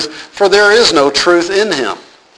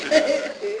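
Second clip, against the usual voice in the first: short laugh; 10 semitones higher, 11 dB quieter than usual. Real, not acted.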